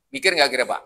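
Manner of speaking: ordinary talk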